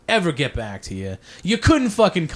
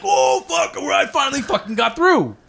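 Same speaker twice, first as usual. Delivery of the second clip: much higher, shouted joy; excited; 9 semitones higher than usual. Retold or imitated. Retelling the call where the booker finally said yes, excited shout. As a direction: yell it like you finally won. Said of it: "I'm like".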